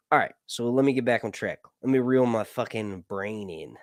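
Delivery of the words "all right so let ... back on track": ordinary delivery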